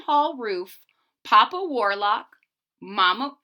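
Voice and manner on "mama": ordinary delivery, nothing marked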